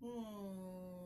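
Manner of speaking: a long closed-lip hum; humming mm sound. Shown as a demonstration. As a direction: hold the mm with lips shut. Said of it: "The mm sound is represented by the letter N".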